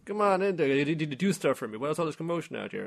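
put-on high, loud bratty kid calling out; bossy rich kid. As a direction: order the butler about like a spoiled boy. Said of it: "this other kid was like".

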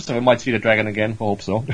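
usual speaking voice

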